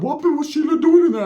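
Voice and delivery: high Ricky. Retold or imitated. Ricky asking the narrator about Sheila, high. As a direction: ask high and suspicious. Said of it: "Ricky's like".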